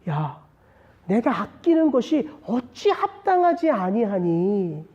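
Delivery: slow, drawn-out, restrained appeal; God addressing us. Acted. God putting His question to preacher and congregation, slow restrained appeal. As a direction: put the question slowly and gravely to the listeners. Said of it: "저와 여러분들에게 던지시는 하나님의 말씀이에요".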